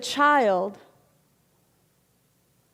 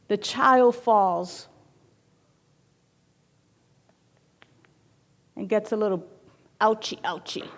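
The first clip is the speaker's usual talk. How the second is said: gentle storytelling; 3 semitones lower, 5 dB quieter than usual. A narrator's reading. Gentle storytelling of child's fall.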